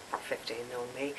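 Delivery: ordinary delivery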